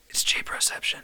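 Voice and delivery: hushed mind-blown reveal; breathy conspiratorial whisper; mock dramatic whisper. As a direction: whisper it like a movie twist.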